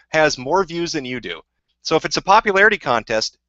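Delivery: usual speaking voice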